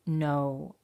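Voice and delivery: plain reading voice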